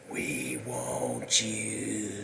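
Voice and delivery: slow, low, drawn-out ghost voice; eerie ghost voice. Pretend. Spirits calling to Sally, slow low moan.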